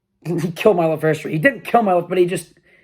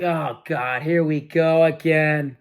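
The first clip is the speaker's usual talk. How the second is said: weary dread; much slower than usual. Retold or imitated. Inner groan at another bad professor.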